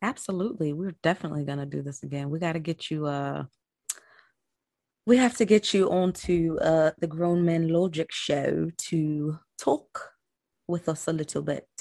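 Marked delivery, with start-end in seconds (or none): 5.1-11.7 put-on slow, stretched British accent. Host inviting guest onto her show in a slow British accent. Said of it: "How was my British accent?".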